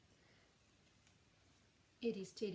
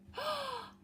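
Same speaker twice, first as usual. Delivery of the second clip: gasp. Acted. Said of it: "cried Eddie".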